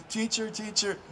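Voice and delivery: put-on high, soft little-boy call of teacher, teacher; eager schoolboy pipes up from the back; kid calling teacher. Schoolboy calls to his teacher, a soft high child's call.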